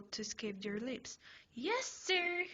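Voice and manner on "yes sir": saluting. A narrator's reading. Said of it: "You salute".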